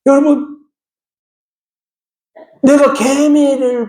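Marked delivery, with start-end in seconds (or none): none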